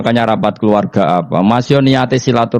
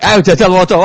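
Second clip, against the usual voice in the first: high, pushy command through laughter; mock stern angel; 10 semitones higher than usual. Mungkar Nakir ordering Umar to read, hammed-up and high. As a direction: play the grave angel barking an order, half laughing.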